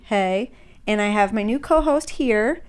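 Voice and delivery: usual speaking voice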